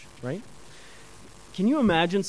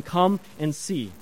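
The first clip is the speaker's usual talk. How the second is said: slow quote; 2 semitones lower, slower than usual. Retold or imitated. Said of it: "He's always saying".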